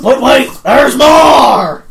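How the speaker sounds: loud, high pitchman shout; infomercial. Acted. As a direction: yell it like a TV salesman. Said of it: "That was a joke I was doing".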